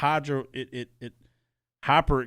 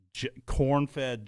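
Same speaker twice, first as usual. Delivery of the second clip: ordinary talk, nothing marked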